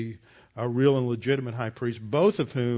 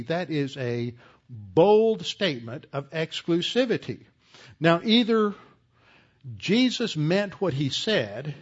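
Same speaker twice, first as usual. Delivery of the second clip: higher, pushing sermon voice; fervent preaching; 4 semitones higher than usual. Acted. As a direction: preach it with rising conviction. Rising preaching about exclusivity.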